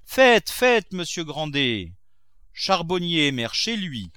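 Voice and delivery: high, loud and self-satisfied maxim; sententious. A narrator's reading. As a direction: pronounce it like a proverb, pleased with himself. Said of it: "dit sentencieusement le président".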